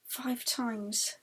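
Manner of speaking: ordinary delivery, nothing marked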